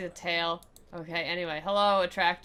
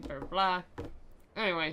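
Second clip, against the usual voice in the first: ordinary delivery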